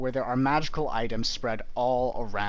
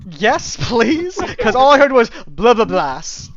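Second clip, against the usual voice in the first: joking loud; 11 semitones higher, 14 dB louder than usual. Real, not acted. Joking about unheard name.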